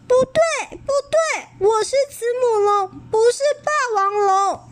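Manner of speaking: little dinosaur shrieking he is not a T-rex; shrill angry denial. A narrator's reading. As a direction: cry it out high and desperate.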